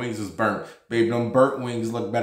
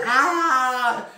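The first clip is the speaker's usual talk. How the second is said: imitated scream; 13 semitones higher than usual. [name] squealing at his friend in the stall, mock shriek. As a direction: let out a giddy squeal. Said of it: "screaming about".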